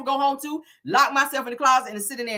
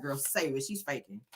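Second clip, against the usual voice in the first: much softer, lower dismissive aside; quiet aside; 10 semitones lower, 12 dB quieter than usual. Real, not acted. Side remark to the viewers while Tisha cries, hushed. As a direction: mutter it off to the side.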